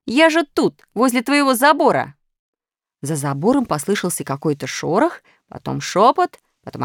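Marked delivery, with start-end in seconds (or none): 0.0-2.2 high call. A narrator's reading. Пятёрка кричит у забора.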